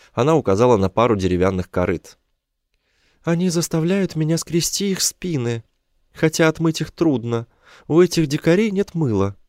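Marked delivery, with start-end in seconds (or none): 3.2-9.4 high female character voice; high-pitched plaintive captive; complaining woman. A narrator's reading. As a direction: grumble about the captors in a high woman's voice.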